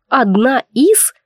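slow, icy cold repetition. Retold or imitated. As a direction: say it slowly, chillingly. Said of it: "таким ледяным тоном заявляет". (ледяным тоном)

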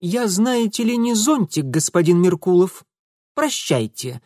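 cold, clipped offended lady; dry and curt. Acted. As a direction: say it coolly and shortly. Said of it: "сухо и коротко Зинаида обронила".

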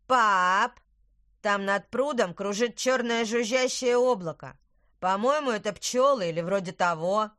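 put-on loud, eager child calling out; excited child. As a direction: call out excitedly to dad. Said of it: "сказал Луис".

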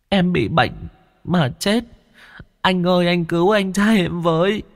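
put-on high small child's voice, still pleading; tearful child ghost. Ghost child telling Thiên how he died, tearful child voice. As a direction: answer like a sniffling little boy.

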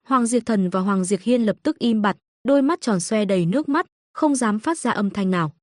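plain reading voice

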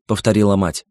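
ordinary delivery, nothing marked

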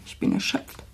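drained, whispered lament; exhausted whisper. Acted. Abandoned mistress to her friend, exhausted whisper. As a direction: whisper it, worn out.

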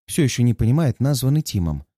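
ordinary delivery, nothing marked

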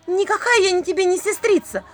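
high, loud outraged cry; shouting. Acted. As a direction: shout the denial. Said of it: "выкрикнула Василиса".

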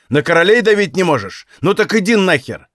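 high, loud retort; angry outburst. A narrator's reading. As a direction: hurl the insult back loudly. Acted quarrel after losing patience.

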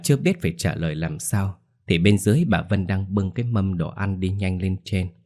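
ordinary delivery

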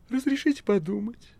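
high, meek cat voice; humble cat. A narrator's reading. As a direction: ask meekly in a squeaky cat voice. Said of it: "смиренно ответил кот".

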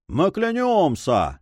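high, drawn-out group vow; crew's cry. A narrator's reading. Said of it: "снова вскричали флибустьеры".

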